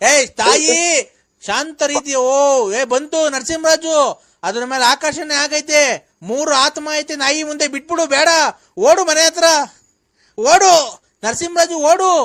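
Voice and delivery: loud, high-pitched commands; urgent shouting. Real, not acted. Ordering Narasimharaju to run home, urgent high shout. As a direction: shout urgent warnings to get someone running.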